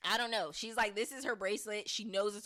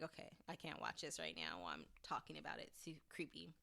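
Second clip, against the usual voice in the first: scared hush; 5 semitones lower, 12 dB quieter than usual. Retold or imitated. Host scared by the show.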